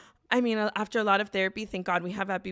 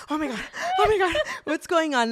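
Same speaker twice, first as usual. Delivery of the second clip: high, fast, laughing panic; frantic panic; 7 semitones higher, 4 dB louder than usual. Retold or imitated. Panicking in the van after the EpiPen, high frantic laughter. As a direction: blurt it out in a giddy adrenaline rush.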